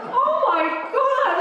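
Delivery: high, loud, stretched laughing exclamation; mock horror. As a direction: overplay the shock. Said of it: "retold shock".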